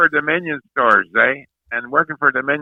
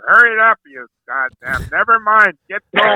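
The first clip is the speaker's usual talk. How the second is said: higher-pitched, mock impatient urging; impatient hurrying-up; 6 semitones higher than usual. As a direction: chivvy them along in a raised pitch. Acted quote hurrying golfers along.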